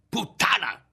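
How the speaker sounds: very high, furious cry; narrated shriek of rage; screamed insult. A narrator's reading. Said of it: "gridandole di tutto".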